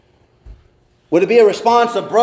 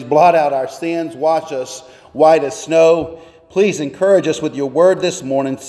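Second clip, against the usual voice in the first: low, hushed prayer voice; 4 semitones lower than usual. Acted. Pastor praying before the sermon, low and hushed. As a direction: pray it gently over them.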